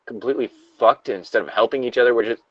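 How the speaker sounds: lightly acted survivor, shocked; reading the survivor's log as the shooting starts; somber log reading, growing disbelief. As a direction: read it with rising horror at the gunshots. Reads the log, rising dismay.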